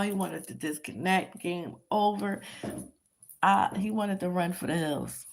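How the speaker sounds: ordinary talk, nothing marked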